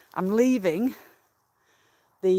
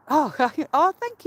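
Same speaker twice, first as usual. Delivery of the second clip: laughing; 8 semitones higher, 5 dB louder than usual. Real, not acted. Delighted by a viewer's comment.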